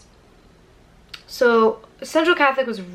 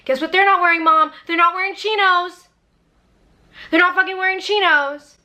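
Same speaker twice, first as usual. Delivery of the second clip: exasperated rant; 9 semitones higher than usual. Acted. Ranting at mom about chinos.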